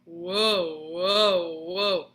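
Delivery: loud wobbling whoa, whoa, whoa; wavy wrong sound. Shown as a demonstration. Teacher imitating the unwanted wavy recorder tone. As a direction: wobble the tone like a wave. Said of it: "This is what we do not want".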